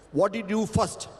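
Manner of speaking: ordinary delivery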